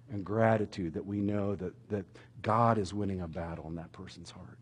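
whispered. Real, not acted. Sermon point sinks to whisper.

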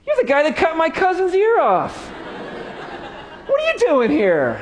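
gleeful accuser. Acted. The servant's cousin confronting Peter: high laughing accusation. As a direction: catch him out and enjoy it. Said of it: "He's like".

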